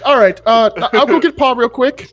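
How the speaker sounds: Ma. Acted. Ma leaving to get Pa, high and bustling. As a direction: bustle off to fetch Pa. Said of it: "peel you off".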